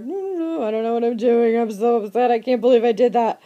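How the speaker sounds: lightly voiced distraught Victor; upset Victor. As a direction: fret to yourself in a rush.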